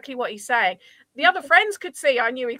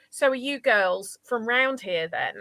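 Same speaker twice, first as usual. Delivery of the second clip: ordinary talk, nothing marked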